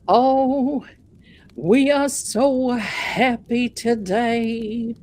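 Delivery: slow, drawn-out mock preacher rejoicing; hammed-up Southern pastor, stretching every word; gushing pastor. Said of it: "Pastor Fun Size is going on".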